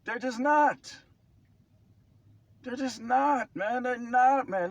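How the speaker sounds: high, loud, exasperated; exasperated outburst. Real, not acted. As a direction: insist over and over, fed up. Exasperated insistence, repeated.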